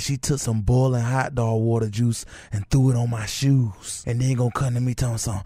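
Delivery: usual speaking voice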